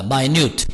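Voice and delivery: minute said my-NUTE, long i as in mine; pronunciation demo. Shown as a demonstration. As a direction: sound minute as mai-nyoot. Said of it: "따라합니다". (minute를 마이뉴트로 발음)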